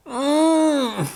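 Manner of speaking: high strained pushing hum; straining hum. Acted. Rabbit pushing hard trying to lay an egg, straining hum. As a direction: squeeze it out with effort.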